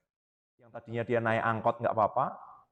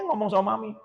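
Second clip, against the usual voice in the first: ordinary delivery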